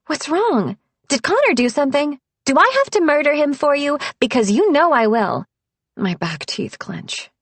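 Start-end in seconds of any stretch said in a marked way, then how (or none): 0.0-5.5 higher, louder, quick alarmed questions; startled friend. A narrator's reading. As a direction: rush out worried questions half joking.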